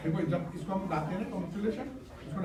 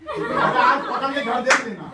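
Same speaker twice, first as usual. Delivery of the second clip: laughing tease; 5 semitones higher, 7 dB louder, slower than usual. Real, not acted. Joking threat, laughing.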